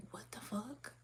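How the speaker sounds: hushed, stunned whisper of disbelief. Retold or imitated. People staring at your strength, whispered shocked disbelief.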